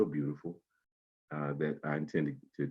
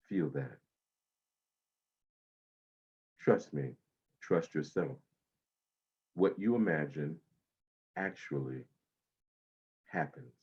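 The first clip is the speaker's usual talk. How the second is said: guided meditation. Acted. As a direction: ease them into calm breathing.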